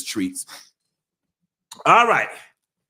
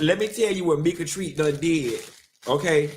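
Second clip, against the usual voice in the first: normal talking voice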